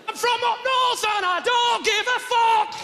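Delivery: high, loud, drawn-out Northern bellow; brash Northern heckler. Retold or imitated. Northern woman walking out of the theatre, high defiant bellow. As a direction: belt it out slowly in a Northern voice.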